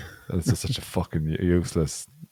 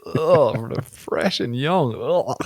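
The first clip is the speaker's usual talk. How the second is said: disgusted; 6 semitones higher, slower than usual. Real, not acted. Disgusted at creepy ad.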